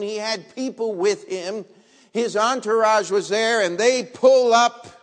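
loud preaching at full force. Acted. Preacher describing the general's arrival, loud preaching.

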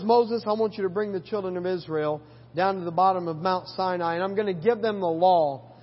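low, hushed voice of God. Retold or imitated. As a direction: speak it like a calm, grave God. Voicing God to Moses.

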